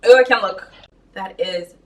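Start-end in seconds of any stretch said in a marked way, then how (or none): none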